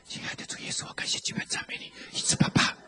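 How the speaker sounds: softly whispered, timid prayer; polite whispered prayer. Retold or imitated. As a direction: whisper it like a meek believer. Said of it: "他以为说基督徒都很客气". (轻声细语的客气祷告)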